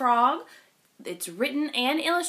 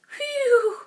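ordinary delivery, nothing marked

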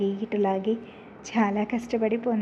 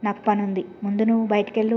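usual speaking voice

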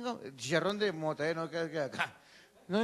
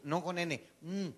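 usual speaking voice

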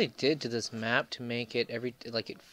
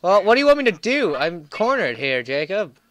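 exasperated protest; 4 semitones higher, 10 dB louder than usual. Real, not acted. Cornered, protesting to teammate.

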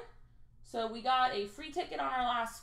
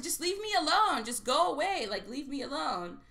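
raised, pitched-up "leave me alone"; retelling her own brush-off; 6 semitones higher, 4 dB louder than usual. Retold or imitated. Telling the rude man to leave her alone, raised and fed up. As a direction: shoo him away, exasperated.

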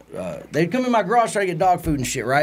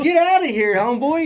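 mock yell; 10 semitones higher than usual. Kicking rat out, yelling at it.

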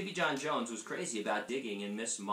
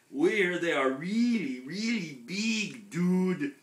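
dog character voice; 7 semitones higher, 7 dB louder, slower than usual. A narrator's reading. Small dog voice Don Diego.

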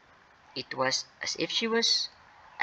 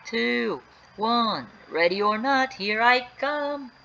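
higher-pitched, drawn-out countdown call; counting in hide-and-seek; 7 semitones higher, much slower than usual. Acted. Mark counting down for hide-and-seek, calling out. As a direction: sing out each count, raised in pitch. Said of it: "hear Mark counting".

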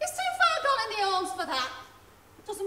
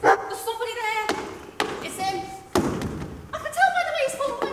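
startled, louder and quicker as she hears him; anxious wife hearing her drunk husband come in, rising alarm; 3 semitones higher, 7 dB louder, faster than usual. Acted. Wife hearing her drunk husband on the stairs, alarmed and hurried.

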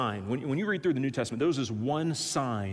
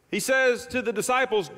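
usual speaking voice

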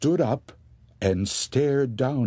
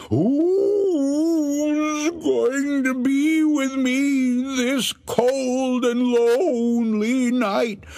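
much higher, slower crying ghostly wail; moaning ghost voice; 4 dB louder, much slower than usual. Acted. Ghost drawing closer to the wife, high crying wail. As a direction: drag out every word like a wailing ghost. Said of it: "heard the voice even closer".

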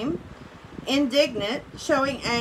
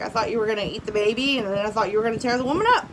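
rushed, fast retelling of the animals; quick paraphrase; 2 semitones lower, much faster than usual. Retold or imitated. Teacher summarising the animals' suspicions, quick and casual. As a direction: tumble the words out fast.

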